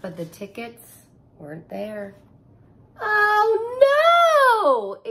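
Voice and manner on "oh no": high, loud crying gasp. Acted. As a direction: gasp in dismay. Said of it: "Ava's mother gasped".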